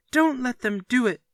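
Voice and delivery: put-on high, loud child's wail; boy wailing to the house. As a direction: wail it up at the ceiling. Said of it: "wailed Peter at the ceiling".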